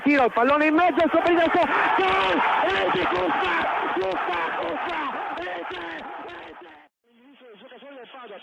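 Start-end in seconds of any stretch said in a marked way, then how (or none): 0.0-6.7 excited goal call; goal shout. Real, not acted. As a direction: roar the goal.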